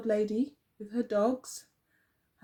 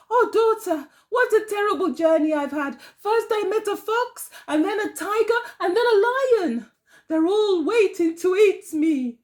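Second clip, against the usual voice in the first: high, louder, distressed wail; frightened old woman; 10 semitones higher, 6 dB louder than usual. Acted. Old woman wailing her fright to her daughter, high and louder.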